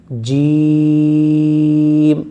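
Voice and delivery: جیم stretched very long on one breath; drawn-out letter name. Shown as a demonstration. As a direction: draw جیم out as far as your breath goes. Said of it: "اور کھینچ کر پڑھنا ہے".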